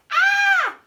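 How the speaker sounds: loud high scream; sudden loud scream; scream for help. Acted. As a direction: shriek for help. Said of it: "But I screamed for help".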